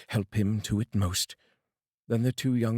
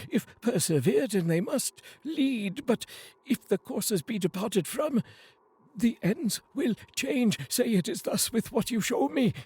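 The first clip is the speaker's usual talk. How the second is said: much higher, slowing desperate plea; pleading Scrooge; 12 semitones higher than usual. Acted. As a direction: beg the spirit to say fate can change.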